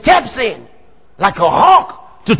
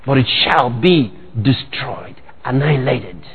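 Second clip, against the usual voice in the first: normal talking voice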